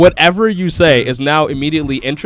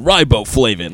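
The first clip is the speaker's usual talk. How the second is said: imitated cartoon voice; 6 semitones lower, 4 dB quieter, slower than usual. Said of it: "when Dipper got the voice".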